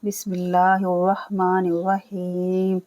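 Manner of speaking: slow, measured Quranic chanting; slow tilawat opening; Quran recitation. Shown as a demonstration. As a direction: intone it as formal recitation. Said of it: "चलिए start करते हैं".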